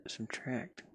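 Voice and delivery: ordinary talk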